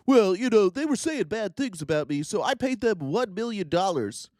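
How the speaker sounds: mocking whiny voice. Mocking the accused who pays off accusers.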